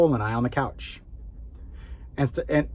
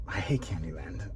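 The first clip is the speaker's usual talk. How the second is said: breathy aside, low and hushed; whispered confession; 7 semitones lower, 9 dB quieter than usual. Real, not acted. Dad admitting to viewers he hates the game, whispered. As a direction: whisper it like a guilty secret.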